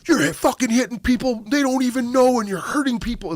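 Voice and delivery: high, whiny mock of an angry stranger; shrill parody of a freaking-out guy. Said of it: "And he's like".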